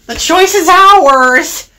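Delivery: high, cheeky freight truck voice; gleeful trucks. A narrator's reading. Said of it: "they laughed".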